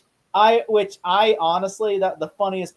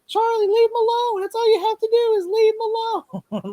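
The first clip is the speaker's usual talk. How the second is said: much higher, pleading voice; yelling advice at the movie in a high mocking plea. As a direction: beg the character in a high put-on voice. Mock-pleading with the character.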